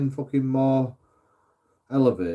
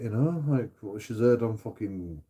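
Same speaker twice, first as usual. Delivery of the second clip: ordinary talk, nothing marked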